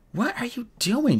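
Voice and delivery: high, drawn-out panting question; mildly out of breath, pitched well up; panted. A narrator's reading. Juliet bursts into the prisoner's cell, mildly panting. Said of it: "she panted".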